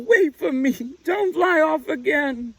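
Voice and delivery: very high, loud desperate pleading; tearful plea. A narrator's reading. Little Owl begging her mother to wait, high tearful plea. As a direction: beg her not to leave, near tears. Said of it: "sobbed Little Owl".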